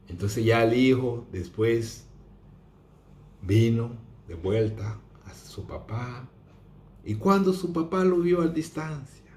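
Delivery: ordinary talk, nothing marked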